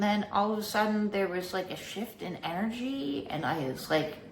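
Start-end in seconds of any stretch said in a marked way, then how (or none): none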